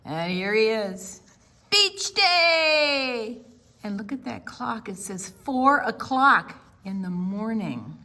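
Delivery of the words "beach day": high, loud happy shout; cheering beach day; boy cheering at the sight of the beach; excited cheer. Acted.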